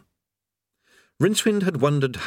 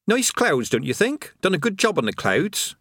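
put-on higher, breezy voice of a chatty old workman; chatty creator; 8 semitones higher than usual. Creator chatting idly beside a falling man. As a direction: natter on as if all is fine.

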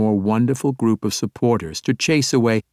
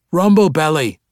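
loud, higher cry of a name; cried out; 8 semitones higher, 7 dB louder than usual. A narrator's reading. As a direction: call out in glad recognition. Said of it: "the two dwarves cried in unison".

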